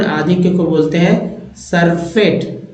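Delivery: ordinary talk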